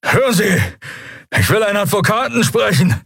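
high-pitched, escalating insistence; increasingly frantic captive; desperate demand. A narrator's reading. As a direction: demand your rights, pitch climbing sharply. Captive demands a lawyer, ignored.

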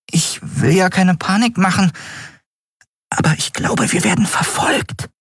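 very high little character voice, hesitant warning; high-pitched, reluctant alarm; delicate, worried companion. Acted. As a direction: warn gingerly in a small high voice. Narrator voices Nelen in dialogue.

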